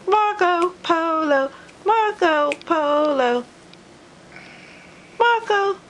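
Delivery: high, sing-song calling; playful call. Acted.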